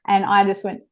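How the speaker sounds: ordinary talk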